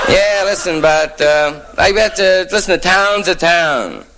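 high-pitched small-town local; local's homespun wisdom. Acted. Local man's reply, acted out.

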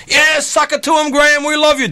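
high, excited crowd shout; mock audience cheer. Audience cheering Graham after he promises to go all night, mock shout. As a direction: cheer like a rowdy fan.

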